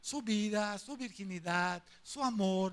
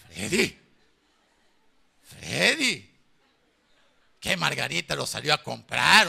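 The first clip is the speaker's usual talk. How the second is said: loud retelling; 2 semitones lower, 10 dB louder than usual. Retold or imitated. Pastor loudly retells his talk with Freddy.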